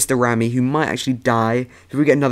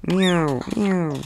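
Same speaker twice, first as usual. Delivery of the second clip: high little meows; cat-like meowing; 6 semitones higher than usual. Acted. Player imitating the spiders' noise in game, high cat meows. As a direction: mimic a cat, high-pitched. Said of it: "They sound like meow".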